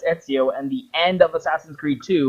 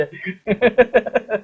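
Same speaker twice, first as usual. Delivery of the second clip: laughing; 7 semitones higher than usual. Real, not acted. Laughing at own bit.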